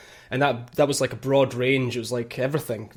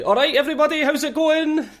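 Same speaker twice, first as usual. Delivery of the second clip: hammed-up Scottish accent; 12 semitones higher, 3 dB louder than usual. Host asks for his best Scottish accent, hammed-up Scottish accent. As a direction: play the kilted Scot in a big, high Scottish accent. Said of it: "in your best Scottish accent".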